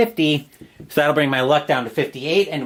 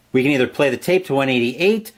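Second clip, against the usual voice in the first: ordinary delivery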